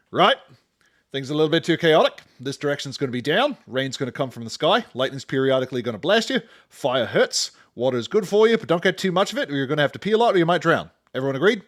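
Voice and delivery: hammed-up, fast, loud British-Australian elemental chairman; bad Australian-British accent. Elementals setting the laws of physics, in a bad Australian-British accent. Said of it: "bad Australian and British accents".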